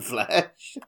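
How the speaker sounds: ordinary delivery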